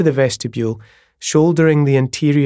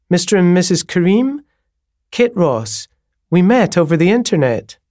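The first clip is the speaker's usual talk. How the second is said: a higher, lilting landlady voice with a lift at each sentence end; eager host, a touch higher and louder, lines lifting up; 7 semitones higher, 4 dB louder than usual. A narrator's reading. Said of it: "the way her voice went up at the end of each sentence".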